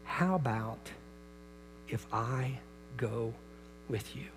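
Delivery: soft, slow, reassuring father; father to scared son, soft and reassuring; gentle offer. Retold or imitated. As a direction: coax him quietly. Father gently offering to jump along.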